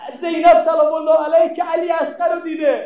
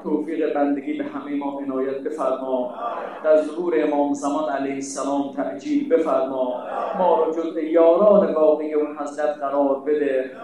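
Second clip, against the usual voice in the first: closing prayer; 10 semitones lower than usual. Acted. Preacher leading the closing prayer for the mourners, low and humble. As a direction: plead humbly with God.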